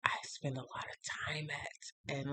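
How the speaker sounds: whispered aside. Real, not acted. Whispered aside to viewers about her place. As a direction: breathe it out under the breath.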